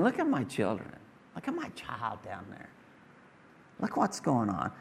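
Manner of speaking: soft, lightly voiced proud father; tender proud whisper. God looking down at his children, lightly voiced soft pride. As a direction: murmur with quiet fatherly pride.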